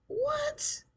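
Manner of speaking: a squealed high, loud what; shocked. Real, not acted. Viewer shocked by the twist, high squealed what. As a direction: shriek it in disbelief. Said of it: "reacting to the show".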